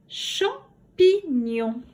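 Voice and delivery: "Champignon" slowly as cham, pi, gnon; syllable by syllable. Shown as a demonstration. Teacher giving the third word, "Champignon" slowly split in three. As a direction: stretch and separate the syllables of "Champignon". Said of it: "Dessine les syllabes".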